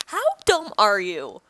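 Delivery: ordinary talk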